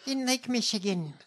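high put-on politician voice; mock the candidate's anecdote voice; Haley Stevens. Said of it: "You sound insane".

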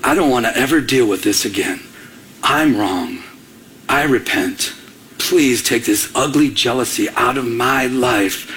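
low, earnest retold prayer; broken repentance. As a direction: plead humbly with God, low and measured. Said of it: "And it broke me".

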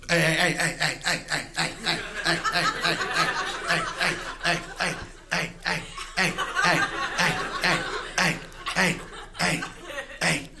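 mild dog voice, slowed heys; dog barking as talk. Acted. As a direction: bark out the heys like a yard dog.

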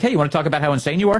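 fast, breezy mock producer. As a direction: rattle it off like a chirpy booker. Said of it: "a Fox producer reaches out and you're like".

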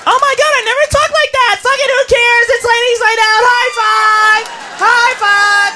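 high, shouty squeal; acted girls'-night glee; excited party girl. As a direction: whoop it up with your girlfriends.

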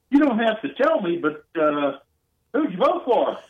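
lightly voiced nosy dad fishing for the answer; sly dad question. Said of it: "he goes, a little bit of that".

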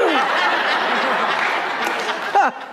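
real laugh at his own joke; amused laughter; hearty laugh. Real, not acted. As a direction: laugh freely. Laughs after punchline.

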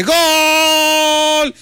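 long, high-pitched shouted goal cry. Acted. Joke teller imitates a goal heard in the phone booth, long shout. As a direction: stretch it out like a football commentator.

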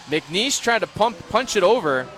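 excited call. Real, not acted.

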